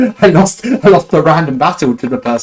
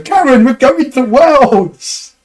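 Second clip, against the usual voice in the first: much higher, louder and slow, drawn-out cheer; excited shout; 13 semitones higher, 6 dB louder, much slower than usual. Retold or imitated. Telling Karen they're going to Worlds, a slow high joyful shout. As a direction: announce it like you've won. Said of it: "and I sort of go".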